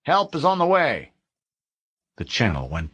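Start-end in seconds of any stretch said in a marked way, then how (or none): 0.0-1.1 higher, louder radio reassurance; officer's last word over the radio. Acted. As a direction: promise help firmly. The voice came back online.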